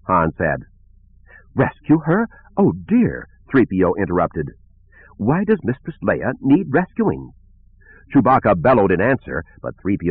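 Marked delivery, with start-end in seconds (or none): 1.5-3.3 high, fluttery robot butler; alarmed droid. A narrator's reading. 5.2-7.3 high, slow, prim robot voice; worried droid. A narrator's reading. Worried droid questions Han.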